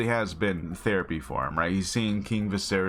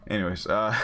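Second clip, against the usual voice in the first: ordinary talk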